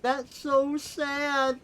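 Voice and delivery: high, slow, hammed-up sob-voice; mock crying. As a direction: whine it like a fake crying kid. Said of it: "Davis just starts crying and is like".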